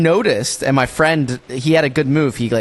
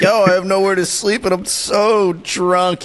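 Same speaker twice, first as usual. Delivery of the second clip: mock tipsy tourist, drawn out and higher; lightly hammed-up drunk girl; 7 semitones higher, slower than usual. Imitating a drunk tourist asking to stay over. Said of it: "he's got a tourist come and be like".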